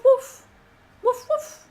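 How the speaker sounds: high yappy woof woof, mocking; a silly mock bark; mock dog barking. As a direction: bark like a little yapping dog. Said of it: "going woof".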